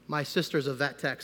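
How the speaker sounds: normal talking voice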